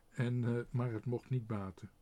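ordinary delivery

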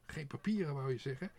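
loud, high indignant tirade; outraged crow, squawking and loud; furious. A narrator's reading. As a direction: rant angrily, piling up the complaints. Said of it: "de kraai zei woedend".

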